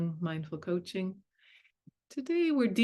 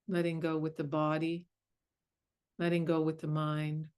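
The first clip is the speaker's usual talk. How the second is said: guided meditation. Acted. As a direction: ease them gently into stillness.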